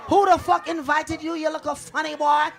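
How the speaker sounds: high, loud mock yell at the comedian; mock host yell. Club host heckling the comic onstage, high and loud. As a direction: bark it down like a rude host. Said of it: "He was like".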